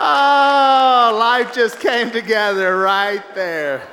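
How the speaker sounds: loud and slow, beaming; excited punchline. Real, not acted. To the crowd, big loud slow punchline. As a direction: savour the moment, loud.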